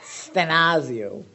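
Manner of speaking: stenazo said slowly with a hammed-up Italian lilt; mock Italian. Shown as a demonstration. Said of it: "Doesn't that sound Italian to you?".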